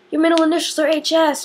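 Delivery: high, put-on voice of a friend; a friend's high, put-on voice; imitated friend. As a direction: squeak it like an excited friend. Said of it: "they were like".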